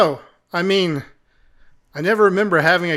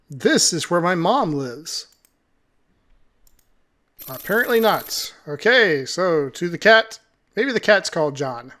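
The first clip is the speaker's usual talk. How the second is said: ordinary talk, nothing marked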